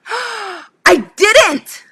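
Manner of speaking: gasp, then a high surprised burst; sudden realization. Real, not acted. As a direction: catch your breath as it dawns on you. Realizes she forgot the story.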